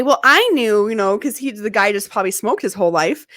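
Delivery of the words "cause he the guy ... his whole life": normal talking voice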